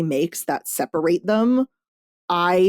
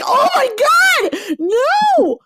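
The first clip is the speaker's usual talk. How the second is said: shrieking high and loud in mock horror; high-pitched mock panic shout; horrified high shout; 5 dB louder, much slower than usual. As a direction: cry out in dismay, high and loud. Horrified reader calling out.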